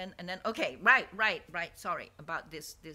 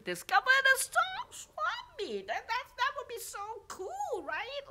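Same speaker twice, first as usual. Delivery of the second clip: very high, gushing excitement; excited; 8 dB louder than usual. Real, not acted. Hoping her friends discover the swap meet, excited. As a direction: squeal it with delight at the idea.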